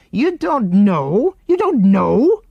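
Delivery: slow, high, loud incredulous repeat; disgusted. A narrator's reading. Man repeats Jill's answer back to her in loud disgust. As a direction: spit out the disbelief. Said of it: "said the man in disgust".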